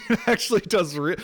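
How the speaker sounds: laughing through the words. Real, not acted. Vlogger laughing at his own upload lesson. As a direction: laugh as you start the sentence.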